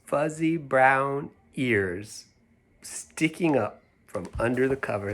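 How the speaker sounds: soft, slow whispered storytelling; hushed reading. A narrator's reading. Reading a picture book to children, in a hushed whisper. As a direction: whisper the page like a secret. Reads story aloud softly.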